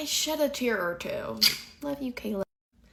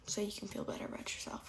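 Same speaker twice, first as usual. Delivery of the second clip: whispering; 11 dB quieter, faster than usual. Real, not acted. Whispered aside to viewers.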